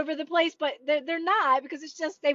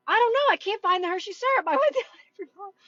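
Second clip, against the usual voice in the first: high, quick mock complaint; put-on exasperation, pitched up; exasperated shopper; 6 semitones higher, faster than usual. Acts out frustrated shopper again.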